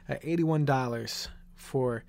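real upset, voice dropping low and soft; emotional. Real, not acted. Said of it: "I apologize for getting a little emotional".

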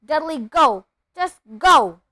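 loud, forceful dismissal; shouted order. A narrator's reading. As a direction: yell it as an exasperated command. Uncle angrily orders Dudley out.

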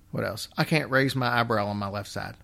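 usual speaking voice

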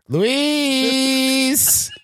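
high, long-drawn singsong call. Acted. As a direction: stretch the name out to summon someone. Calling the cook out from the kitchen.

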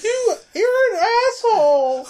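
high, loud, laughing mock shout of a woman; mock outraged shout. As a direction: shriek it back at him through a laugh. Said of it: "she was like".

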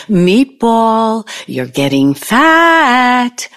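mock singsong jibe; imitating his teasing. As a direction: mimic him, stretching the last word high. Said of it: "did he ever say to you".

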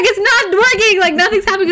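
high, frantic mock scream; mock panicked cry. Felicity on a runaway horse, high frantic cry. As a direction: cry out as the horse runs wild. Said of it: "screaming and she's trying to hold on".

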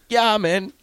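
high, loud, instant mock agreement; retold eager yes. Coach re-enacting his answer to players, eagerly high. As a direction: jump in on a high pitch.

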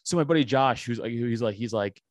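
ordinary delivery

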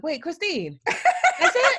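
very high, laughing disbelief; laughing incredulity at her mentor; incredulous. Retold or imitated. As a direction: squeak out disbelief through a laugh. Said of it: "I'm like".

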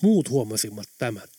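plain reading voice

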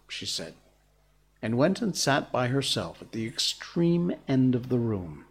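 usual speaking voice

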